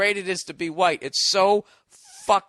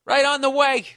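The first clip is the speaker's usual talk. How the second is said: eager clerk; 7 semitones higher, 5 dB louder than usual. Pretend. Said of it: "They go".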